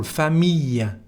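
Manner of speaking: "famille" ending in an ee-y glide, no L; "famille" said as "fa-meey". Shown as a demonstration. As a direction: say the ille of "famille" as "eey". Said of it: "the sound is E".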